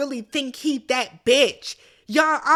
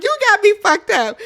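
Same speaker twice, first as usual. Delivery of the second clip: indignant retort; 8 semitones higher, 6 dB louder than usual. Retold or imitated. Acting Bob's angry retort.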